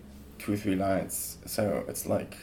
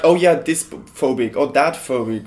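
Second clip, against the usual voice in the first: mock yell; 4 semitones higher, 9 dB louder than usual. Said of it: "you just sometimes yell stuff at people".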